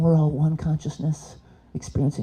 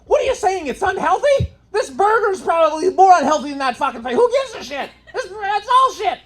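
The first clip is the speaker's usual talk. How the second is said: much higher mock bro; mocking the guy. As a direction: squeak it like a dismissive bro. Guy voiced again.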